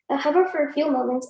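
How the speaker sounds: ordinary talk, nothing marked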